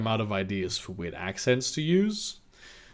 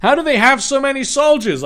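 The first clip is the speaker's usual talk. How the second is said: higher, louder acted question; exclaimed story dialogue; 8 dB louder than usual. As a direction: read it out as a loud, high question. Acted quote in story reading.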